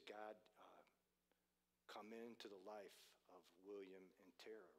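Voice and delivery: low, hushed praying voice; soft prayer. Retold or imitated. Preacher praying for stranded couple, low and hushed. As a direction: pray it quietly and tenderly.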